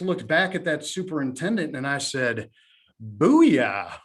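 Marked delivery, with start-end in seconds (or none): 3.0-4.0 low, loud gloating shout; triumphant booyah. Real, not acted. To the doubting superintendent, a loud gloating booyah. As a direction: slam it home like a winning point.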